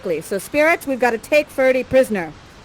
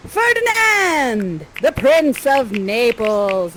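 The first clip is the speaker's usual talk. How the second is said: drawn-out showman's reveal, pitched up; grand introduction; much slower than usual. Acted. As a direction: announce the prince with a slow flourish.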